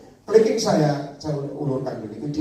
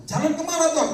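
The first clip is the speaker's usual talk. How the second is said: puzzled question; 12 semitones higher than usual. Acted. Preacher voices his own question.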